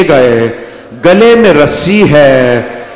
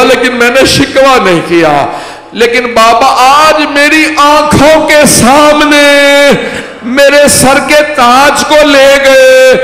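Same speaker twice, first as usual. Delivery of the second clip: high, slow, keening plea that breaks into a cry; wailing lament; 9 semitones higher, 4 dB louder than usual. Acted. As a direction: cry it out as a grieving daughter at her father's grave. Fatima's lament in majlis recitation. (ऊँची, धीमी, विलाप करती आवाज़)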